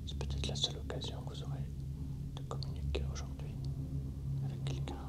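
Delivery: soft unvoiced whisper to a spirit. Real, not acted. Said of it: "je murmure".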